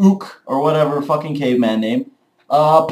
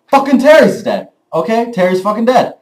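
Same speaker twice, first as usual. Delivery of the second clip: shocked outburst; 7 semitones higher than usual. Retold or imitated. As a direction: blurt it out like the whole room just heard. Everybody reacting to Terry's death.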